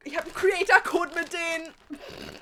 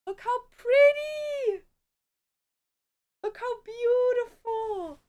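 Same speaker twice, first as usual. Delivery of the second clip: gushing; 8 semitones higher, slower than usual. Real, not acted. Showing off gifted dresses.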